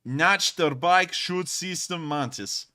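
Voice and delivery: slow, deliberate mock Russian accent on a German name. Streamer reading a German weapon name aloud, in a mock Russian accent. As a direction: sound out the name like a Russian, slowly. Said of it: "I read it with a Russian accent".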